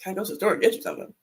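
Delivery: retold husband tossing the request back; mock husband's echo. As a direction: rattle it back quietly, very fast. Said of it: "He's like".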